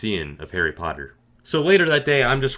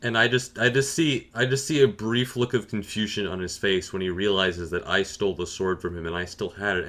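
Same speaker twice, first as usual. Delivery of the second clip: normal talking voice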